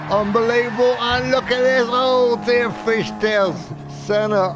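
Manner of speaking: sudden burst of race-call excitement; excited commentary. Real, not acted. Crash during live race commentary.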